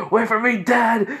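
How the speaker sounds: high, loud imitated yell; calling out. Son running after his dad, calling out. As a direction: call after someone leaving you behind.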